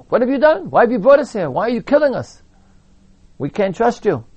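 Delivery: high, loud mock accusation of Moshe; hammed-up angry yelling.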